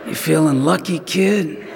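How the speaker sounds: squint and mutter it like a cowboy gunslinger, half laughing; Clint Eastwood squint. Retold or imitated. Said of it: "Clint squinting".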